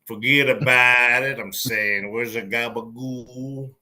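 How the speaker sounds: slow, hammed-up Jersey mobster accent; mock New Jersey mobster. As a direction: play a wise guy from Jersey. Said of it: "talk like this".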